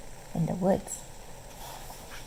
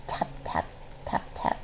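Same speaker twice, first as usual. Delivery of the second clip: whispered tap tap like a paw on a door; tapping sound; 10 dB quieter than usual. A narrator's reading. Reading to children, the kitten taps at the door in a soft whisper. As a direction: breathe the taps softly, like a drummer's light beat.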